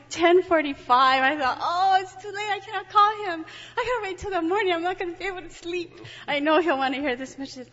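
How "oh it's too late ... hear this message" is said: high, agitated inner thoughts; excited. Retold or imitated. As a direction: fret out loud, worked up.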